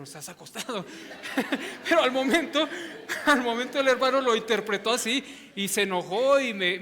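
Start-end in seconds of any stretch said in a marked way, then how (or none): 0.9-1.8 breathy high laugh at his own story; burst of laughter. Real, not acted. Pastor laughing at his own humiliating story, breathy. 1.9-6.8 loud and high, laughing through the words; laughing while talking. Real, not acted. Pastor cracking up retelling how he was yelled at, loud and laughing. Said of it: "ya saqué mi trauma".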